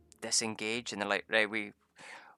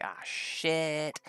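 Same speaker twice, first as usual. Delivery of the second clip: higher, drawn-out mock alarm; acted dismay; 5 semitones higher than usual. Party learning the Chimera is gone, acted dismay. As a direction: stretch out a raised cry of dismay.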